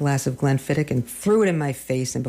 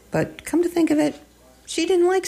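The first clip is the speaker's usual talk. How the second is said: high afterthought. Acted. Afterthought, much higher pitch.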